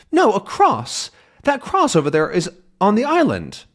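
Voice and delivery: insist, pointing it out; young reporter insisting, lightly voiced; insistent Tintin.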